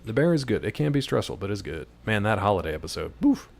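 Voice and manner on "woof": usual speaking voice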